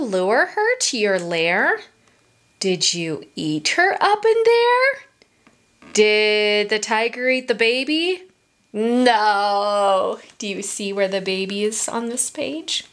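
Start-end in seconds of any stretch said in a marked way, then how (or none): none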